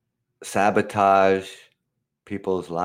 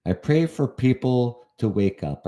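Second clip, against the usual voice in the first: ordinary talk, nothing marked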